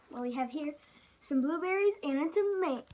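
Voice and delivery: ordinary delivery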